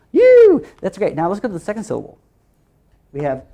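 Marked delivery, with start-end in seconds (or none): 0.1-0.6 loud, high-pitched "woo"; high celebratory whoop. Real, not acted. Teacher cheering a finished syllable, high loud whoop.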